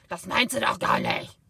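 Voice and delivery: imitating mother's loud harsh shout; mock shouted German accusation. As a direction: blast it out the way she used to speak. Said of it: "That's how she spoke".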